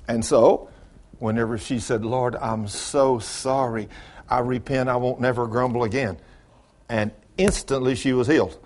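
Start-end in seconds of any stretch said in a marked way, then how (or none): 2.0-6.2 hushed, sorry plea to the Lord; humble repentance. Retold or imitated. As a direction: recount her apology quietly and penitently. Woman repenting in prayer, retold.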